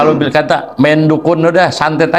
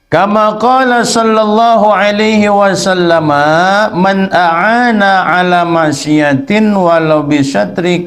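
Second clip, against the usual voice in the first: higher, slow, drawn-out Arabic reading; kitab recitation; 4 semitones higher, much slower than usual. Acted. Teacher reciting a hadith to students, slow raised recitation. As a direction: recite the Arabic text slowly, voice raised in pitch. Reads Arabic text aloud.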